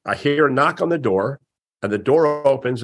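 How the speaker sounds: ordinary talk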